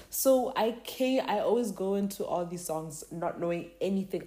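ordinary delivery

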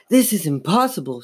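plain reading voice

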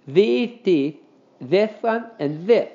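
high lisped "These teeth, this one and this"; lisping. A narrator's reading. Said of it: "he lisps".

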